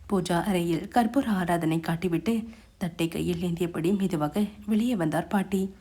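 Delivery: ordinary delivery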